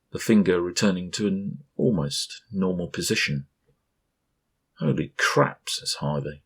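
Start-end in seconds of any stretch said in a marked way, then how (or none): 4.8-5.7 higher startled outburst; shocked exclamation. A narrator's reading. Guest reacts to the finger twist, higher startled blurt.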